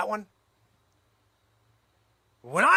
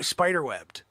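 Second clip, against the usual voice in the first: usual speaking voice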